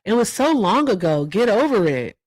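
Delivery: mock brush-off, get over it; mocking dismissive quote. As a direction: parrot the people who brush it off. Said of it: "They always tell us".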